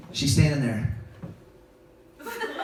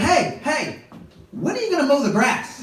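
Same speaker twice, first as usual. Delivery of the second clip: wife voice; 10 semitones higher than usual. Retold or imitated.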